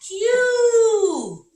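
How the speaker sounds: high, loud, delighted answer; big cheer for the letter; excited cheer. Acted. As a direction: celebrate the letter like a win.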